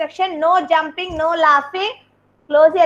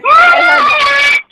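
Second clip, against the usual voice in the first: loud; 10 semitones higher, 8 dB louder than usual. Real, not acted. Teacher catches child talking.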